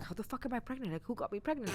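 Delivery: under your breath, freaking out; panicked inner voice. Retold or imitated. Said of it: "I'm panicking. I'm like".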